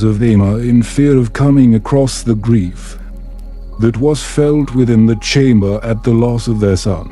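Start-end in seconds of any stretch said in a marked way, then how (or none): none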